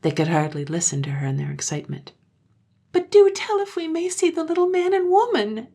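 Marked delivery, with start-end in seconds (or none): 2.9-5.7 put-on high, loud child voice begging; high childish begging; entreating children. A narrator's reading. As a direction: plead like little children. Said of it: "they entreated again".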